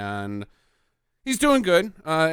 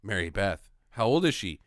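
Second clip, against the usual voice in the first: ordinary talk, nothing marked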